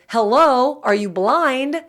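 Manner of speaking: mock snippy retort to a line cutter; sarcastic jab. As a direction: sneer it out like you've lost patience. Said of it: "what you really want to say is".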